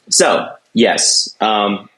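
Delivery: normal talking voice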